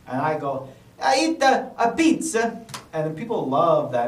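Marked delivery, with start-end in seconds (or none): 1.0-2.6 comic Italian accent, pitched up. Shown as a demonstration. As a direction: put on a playful Italian accent. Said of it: "You can still do an Italian accent".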